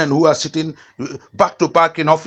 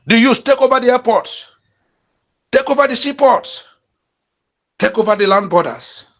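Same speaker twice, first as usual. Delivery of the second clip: real angry shout, much higher and louder; aggravated; 9 semitones higher, 4 dB louder than usual. Real, not acted. Urging youths to seize airports, furious shout. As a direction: roar the commands at the youths. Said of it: "sorry if I sound so aggravated".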